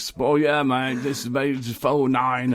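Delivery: put-on high, slow garbled New Zealand accent; mock New Zealand accent. Imitating New Zealanders at an online meeting, garbled New Zealand accent. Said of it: "they all talk funny".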